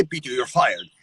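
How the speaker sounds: put-on accented character voice; lightly voiced accented boss; Mister Weed. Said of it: "I was Mister Weed on Family Guy".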